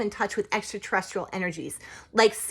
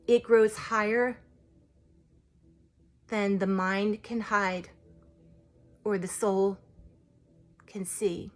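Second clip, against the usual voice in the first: slow, hushed verse with long pauses, fading to a whisper; poem recitation. Acted. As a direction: recite reverently, letting the last words fade away.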